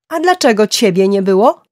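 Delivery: loud, high question rising at the end; shouted retort. A narrator's reading. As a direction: fling the accusation straight back. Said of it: "krzyknęła Gillian w odpowiedzi".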